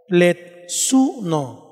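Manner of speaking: "Pletsuno" drawn out very slowly, accent on the u; very slow teaching pronunciation, plets-U-no; stressed on u. Shown as a demonstration. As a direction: model "Pletsuno" syllable by syllable for them to repeat. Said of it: "Lleva el acento en la U".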